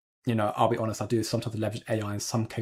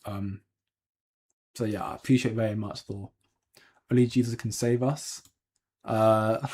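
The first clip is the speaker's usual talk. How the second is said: ordinary talk, nothing marked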